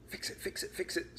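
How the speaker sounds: panicked inner voice. Acted. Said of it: "screamed a wordless voice in his head".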